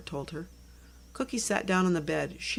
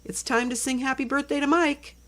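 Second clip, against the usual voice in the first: higher, louder call from another room; 7 semitones higher, 4 dB louder than usual. Acted. Mom calling the girls in for birthday song, raised and high. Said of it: "Laura's mom called out".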